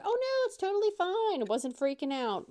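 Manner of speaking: high, loud mock breezy reply; fake cheerful reassurance. Answering the late host, high and falsely breezy. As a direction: pretend it's totally fine while panicking. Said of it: "you're like".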